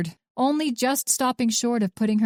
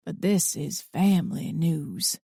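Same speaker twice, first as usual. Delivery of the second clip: "family" leaned on, ominous and slow; stress on family; 3 semitones lower, slower than usual. A narrator's reading. Old man warning the agent, stressing "family" darkly. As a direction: make "family" sound like a threat. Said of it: "stressed the word family as though it should frighten her".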